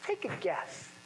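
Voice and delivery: softly smiling "Take a guess"; smiling through a mask. Shown as a demonstration. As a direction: let the grin carry "Take a guess" behind a mask. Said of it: "can you tell if I'm smiling or not".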